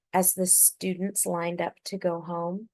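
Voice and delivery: ordinary delivery, nothing marked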